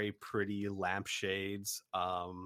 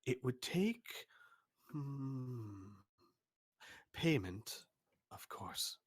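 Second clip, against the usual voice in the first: softer, lightly voiced pondering craftsman; musing shopkeeper; 7 dB quieter than usual. Shopkeeper weighing the job in a soft musing voice. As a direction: think it over half to yourself. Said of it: "he looks at it and he says".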